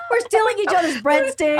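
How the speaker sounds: high laughing yell; laughing shout. Real, not acted. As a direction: shout it through tears of laughter.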